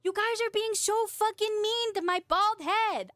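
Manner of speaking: high, drawn-out playful complaint; mock-hurt whine. Playful complaint at chat's teasing.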